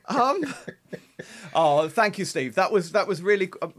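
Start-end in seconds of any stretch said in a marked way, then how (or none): none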